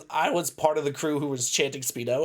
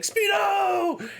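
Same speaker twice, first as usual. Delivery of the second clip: high shouted chant, much higher; mock crowd shout. Friend in the crowd shouting chant, mock yell. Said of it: "you see me in the crowd like".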